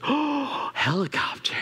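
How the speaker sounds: put-on small boy's hushed awe; awed little boy. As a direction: imitate a child's sudden wonder. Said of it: "He goes".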